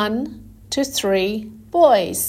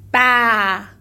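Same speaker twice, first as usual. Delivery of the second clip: sheep baa; 4 dB louder than usual. Shown as a demonstration. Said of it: "said the cow".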